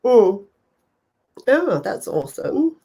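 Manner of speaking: usual speaking voice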